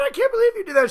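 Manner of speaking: high, lightly voiced wife scolding; mock wife yelling. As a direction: play the stunned wife telling him off. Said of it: "She'd yell at me for a while".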